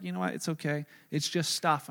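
quiet, gentle reassurance; soft reassurance. Retold or imitated. Wife texting the pastor that it's fine, quiet and gentle. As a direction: tell him softly it's only stuff. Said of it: "She's like".

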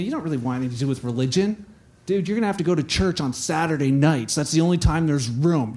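low, casual talking-out-of-it; dissuading. Acted. Doubters talk Bart out of religion, dismissive. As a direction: put him off like a buddy.

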